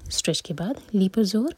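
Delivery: ordinary talk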